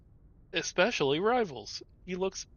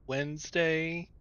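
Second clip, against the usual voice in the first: drawn out; much slower than usual. Real, not acted. Drawn-out stream announcement.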